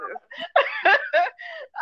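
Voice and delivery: high bright laugh trailing down; burst of laughter. Real, not acted. Laughing at her own joke, a loud high laugh. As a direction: laugh out loud, then let it fall. Laughing at herself mid-story.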